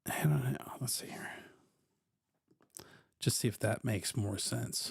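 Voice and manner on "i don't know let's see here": unvoiced mutter under his breath; whispering to himself. Real, not acted. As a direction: whisper it like you are thinking aloud. Reading to himself, unsure.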